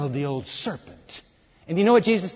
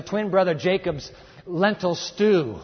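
normal talking voice

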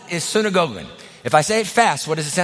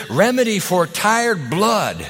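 usual speaking voice

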